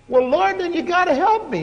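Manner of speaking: high, insistent pleading; whiny plea. Retold or imitated. Preacher retells insisting God help him, high whiny plea. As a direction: demand help like a frustrated kid.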